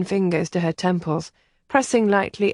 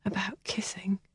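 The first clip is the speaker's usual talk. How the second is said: whispered; 12 dB quieter than usual. Acted. Helen whispers her sorrow to Kathleen. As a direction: breathe out the disappointment. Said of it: "she whispered".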